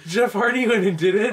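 high, loud incredulous fan; mock outrage. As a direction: squeal it like a shocked fan who can't believe it. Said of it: "And people are like".

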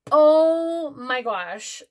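drawn-out rising oh my gosh; slow thrilled oh my gosh; excited gasp. Real, not acted. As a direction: gush with delight. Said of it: "I'm so excited for this".

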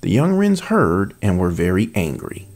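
expressive read-aloud narration; storyteller voice with character lines; storybook reading. A narrator's reading. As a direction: read the fairy tale to an audience. Reads fairy tale aloud as sample.

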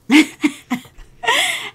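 a high, bright burst of laughter; real high laugh at something cute; delighted laugh. Real, not acted. Laughs delighted at doll's hair.

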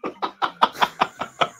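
breathy, voiceless laugh; wheezy chuckle. Real, not acted. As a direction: laugh helplessly at the idea. Laughing at the song request.

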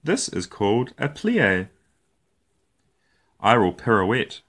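ordinary delivery, nothing marked

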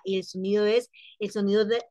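normal talking voice

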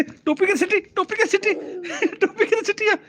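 very high, squeaky-pitched teasing question; playful baby talk. Pretend. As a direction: coo it high at a toddler, amused. Parent playfully questioning toddler about hat. (ਬੱਚੇ ਨਾਲ ਲਾਡ ਵਾਲੀ ਉੱਚੀ ਤਿੱਖੀ ਆਵਾਜ਼)

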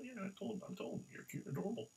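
hushed, gentle words to a teddy; soft whisper. Acted. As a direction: whisper it sweetly to the bear. Telling the teddy softly.

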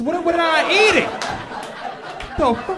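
high, loud mock disbelief; hammed-up outrage at the food; mock outraged yell. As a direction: yell at the plate in fake horror. Comic outrage at weird food.

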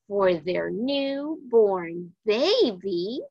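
usual speaking voice

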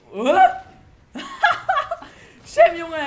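very high, loud burst of laughter; shrieking laugh. Real, not acted. Gamer laughing at Sam's botched throw, shrieking laugh. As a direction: crack up hard at the mistake. Teammate's grenade flies up.

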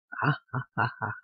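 quick hearty ha-ha-ha; hearty laugh. Acted. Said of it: "彼は笑った".

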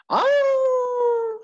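a high, loud drawn-out dog howl. Acted. Winn-Dixie howling from outside the church, high and loud. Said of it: "said Winn-Dixie".

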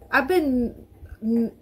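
nervous. Real, not acted. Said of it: "it's been a long time since I've been this nervous".

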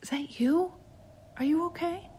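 put-on high child voice, gently asking; lightly hushed boy's question; soft-spoken boy. A narrator's reading. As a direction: ask softly as a small boy. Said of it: "he spoke softly".

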